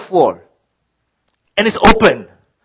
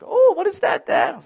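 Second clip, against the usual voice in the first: put-on high, light child's voice; kids' voice. Kids asking dad about the remote, high and curious. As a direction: chirp it like a curious little kid.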